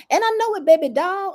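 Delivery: high, sing-song tease; playful brag. Pretend. Pisces talker teasing her listeners, mock cocky sing-song. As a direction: toss it off like a cocky poet.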